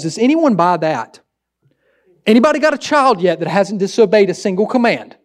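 usual speaking voice

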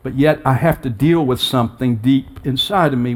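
ordinary delivery

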